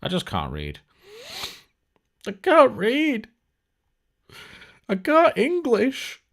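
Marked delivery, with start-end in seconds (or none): 2.3-6.2 much higher and slow, a put-on dopey voice; mock dim voice. Host mocking his own misreading, slow high dopey voice. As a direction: play the fool, drawn out and high-pitched.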